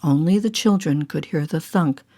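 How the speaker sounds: plain reading voice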